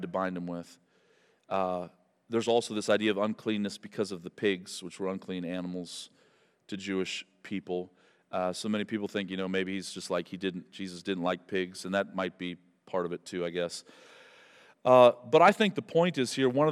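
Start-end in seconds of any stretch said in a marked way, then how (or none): 2.7-13.8 quiet aside. Real, not acted.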